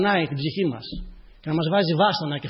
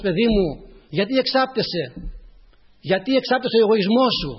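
higher, forceful fatherly reproof; reproachful questioning; 6 semitones higher, 7 dB louder than usual. Acted. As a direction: press the question like a reproving Lord. Preacher voices the Lord's reproach.

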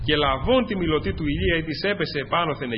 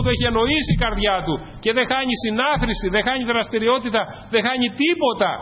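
higher-pitched, insistent sermon delivery; fervent preaching; 7 semitones higher than usual. Real, not acted. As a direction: drive each phrase home to the congregation. Preaches with rising fervour.

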